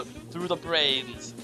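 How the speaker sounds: ordinary delivery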